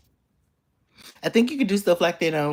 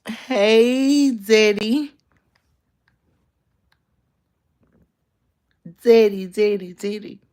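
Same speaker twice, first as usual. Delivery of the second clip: playful calling; 6 semitones higher, 5 dB louder, much slower than usual. Pretend.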